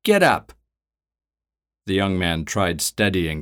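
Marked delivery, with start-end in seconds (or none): 0.0-0.5 high, loud command; barked order. Acted. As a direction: snap the order down at her. Captor orders the old woman.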